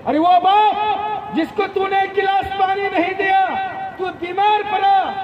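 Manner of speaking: high, driving sermon delivery, phrase after phrase; impassioned preaching. Acted. Preacher urging the young to value their parents, impassioned.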